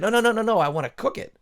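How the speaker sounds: high, loud, hurried no no no; urgent protest. Acted. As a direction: stop him before he bites. Mike stops raccoon eating raw fish.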